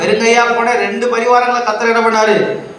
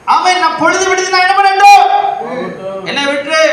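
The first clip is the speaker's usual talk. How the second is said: preaching at full force; 9 semitones higher than usual. Real, not acted. Preacher acts out the angel asking Jacob to release him, high and fervent. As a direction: voice the angel's plea with pulpit fervour.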